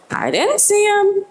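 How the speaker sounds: lightly voiced small child, high and unsure; child's voice. Child telling the mother he didn't see Alex, high and small. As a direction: answer like a little kid who didn't notice.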